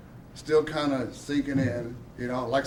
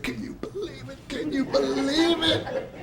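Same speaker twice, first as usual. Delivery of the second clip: high and giddy, laughing through it; giddy disbelief; 10 semitones higher than usual. Retold or imitated. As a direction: gush with laughing amazement. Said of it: "they were all giddy".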